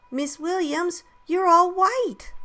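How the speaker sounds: put-on high child voice, loud drawn-out finish; giggly child. A narrator's reading. Children spotting their dusty teacher, amused child voice. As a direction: pipe up like an amused little kid. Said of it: "they started to giggle".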